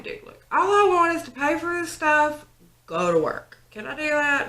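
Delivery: high exasperated whine to the manager. Retold or imitated. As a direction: ask it like you're at the end of your rope.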